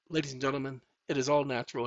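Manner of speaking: normal talking voice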